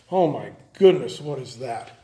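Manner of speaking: a lightly acted student stunned by the board; mock dismayed student. Student panicking at first math class.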